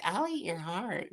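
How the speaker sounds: mock cute. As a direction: say it sweetly with a giggle, as a playful threat. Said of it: "Over here, all cute".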